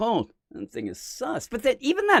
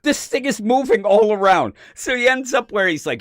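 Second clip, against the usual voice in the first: ordinary delivery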